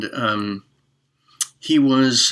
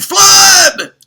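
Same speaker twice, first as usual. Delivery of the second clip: much higher, loud shout of Flood!; 14 dB louder than usual. Acted. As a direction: scream Flood! as a panicked alarm. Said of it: "And he cried".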